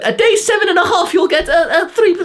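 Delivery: very high, laughing parody of a YouTuber; squeaky mocking voice. Ridiculing nofap YouTubers to viewers, squeaky high mockery.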